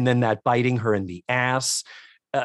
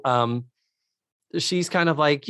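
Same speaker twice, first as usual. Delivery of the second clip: usual speaking voice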